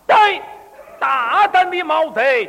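high, drawn-out theatrical hero's shout; 大喊一声. Acted. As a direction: bellow a slow stage challenge at the thief. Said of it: "我大喊了一声". (戏腔拖长声高喊)

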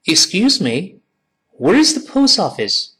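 high, clear learner-model reading; high-pitched polite question for repetition. Shown as a demonstration. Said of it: "跟老师再念一遍".

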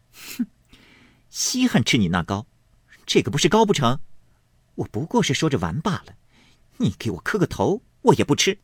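lightly acted mockery, tossing her head; taunting girl. A narrator's reading. Fangguan taunts Chanjie'er. (俏皮挖苦的小丫头)

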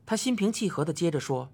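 ordinary delivery, nothing marked

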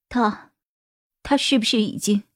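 shaky, hesitant, dreading the answer; trembling voice. Acted. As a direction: let the voice quiver and stall. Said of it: "他的声音 有些颤抖".

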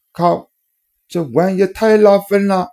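high, loud, thrilled outburst; excited exclamation. A narrator's reading. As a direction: gush with delight. Awed exclamation at warship.

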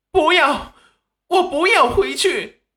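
very high, loud cry of refusal; agitated. A narrator's reading. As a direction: protest in a sudden panicky outburst. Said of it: "韩秀秀立即激动地说道".

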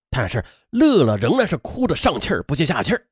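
plain reading voice

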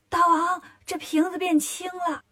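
high-pitched little demon voice; alarmed minion. Acted. Minion reports a strange bottle to his king, high alarmed voice.

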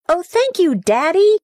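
put-on boy thanking his father for the money, delighted; excited child's cry. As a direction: squeal your thanks like a thrilled little boy. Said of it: "he yelled".

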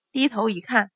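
ordinary delivery, nothing marked

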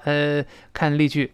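ordinary talk, nothing marked

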